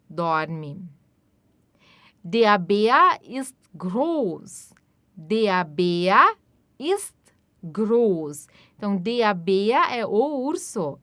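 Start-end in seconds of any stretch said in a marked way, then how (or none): 2.2-8.5 very slow, clear and even; slow model sentence. Shown as a demonstration. Teacher modelling sentence slowly for repetition. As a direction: spell it out slowly for learners. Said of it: "teacher models sentence".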